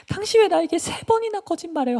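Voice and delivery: very high, loud accusation; acted exasperated wailing; nagging reproach. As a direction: complain bitterly, badgering. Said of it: "따라다니면서 막 졸랐어요".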